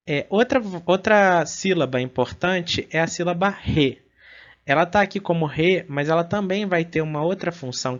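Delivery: normal talking voice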